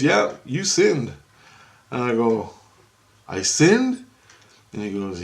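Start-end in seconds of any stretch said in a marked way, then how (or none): 0.0-1.1 slow, firm retold accusation; emphatic verdict. Retold accusation, then echoed back. 3.3-4.0 high rising question of disbelief; incredulous echo. Retold or imitated. Retold echo of the accusation.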